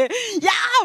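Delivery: sudden high shout of a quarrel; imitating a furious outburst; mock angry yell. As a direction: bark it like someone picking a fight. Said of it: "막 이러면서 막 싸우는 거죠".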